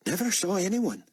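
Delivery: put-on high, nervous boy voice; young boy. A narrator's reading. Boy answering Buck's questions, nervous. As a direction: answer hesitantly. Boy's voice.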